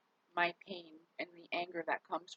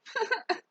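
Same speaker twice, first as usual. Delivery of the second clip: high burst of laughter; 5 dB louder than usual. Real, not acted. Laughs at pet Marvin.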